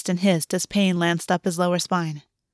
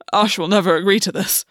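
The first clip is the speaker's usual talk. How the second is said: rasping. A narrator's reading. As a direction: rasp it out, blindsided. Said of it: "Gwen rasped".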